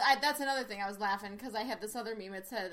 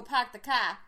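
put-on louder r-less Boston accent; 6 dB louder than usual. Host showing off the accent, broad Boston. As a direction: drop the r's like a Bostonian. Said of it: "I was made for a Boston accent".

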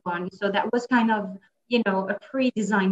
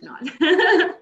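high, bursting into laughter on the words; a real laugh breaking through; 9 semitones higher, 5 dB louder than usual. Real, not acted. Guest jokingly takes it back to the host, laughing. Teasing self-correction, laughing.

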